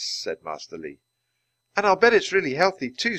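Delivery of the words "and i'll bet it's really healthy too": put-on high, loud eager little girl; Annie's high hopeful voice. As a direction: chirp it like an excited kid. Girl character voice.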